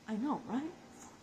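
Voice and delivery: ordinary talk, nothing marked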